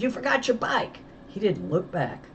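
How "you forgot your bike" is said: high call across the yard. A narrator's reading. Girl calls after her friend who runs off, calling out. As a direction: keep calling after him. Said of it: "I shouted".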